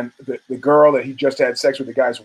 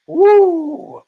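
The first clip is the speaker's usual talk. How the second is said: long, high-pitched whoop; excited whoop; 5 dB louder than usual. Real, not acted. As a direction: let out a loud, high "woo". Storyteller reacts to the fight.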